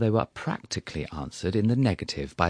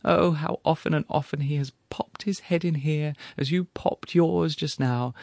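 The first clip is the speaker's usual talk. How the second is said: widow voice; 8 semitones higher than usual. Acted. Grieving widow reminiscing.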